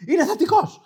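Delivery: high-pitched mock shriek of an announcement; hammed-up high yell; imitated shouting. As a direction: blurt it out like an alarmed clerk. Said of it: "φωνάζει με αυτή τη φωνή".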